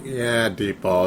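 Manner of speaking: low, slow deadpan answer; flat resignation. Retold or imitated. Fan answering his own question, slow resigned deadpan. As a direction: let it drop, slow and quiet. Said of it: "It's like".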